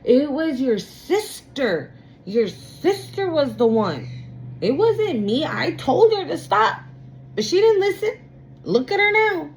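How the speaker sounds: high, rising voice of a parent shifting blame; imitating the parent pushing blame onto the sister; mock blaming parent. Tarot reader mimics parent blaming the sister, high indignant. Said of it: "with that communication, like, "Oh yeah".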